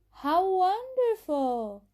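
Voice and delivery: thrilled response to good news; delighted reply. Acted. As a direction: gush over the new watch. Dialogue line responding to good news.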